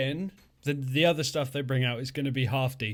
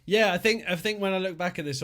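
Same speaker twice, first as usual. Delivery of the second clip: ordinary talk, nothing marked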